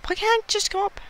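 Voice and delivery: a voice that shoots way up in annoyance; squeaky frustration. Real, not acted. Stuck player whining at the game, squeaky high.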